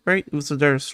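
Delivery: usual speaking voice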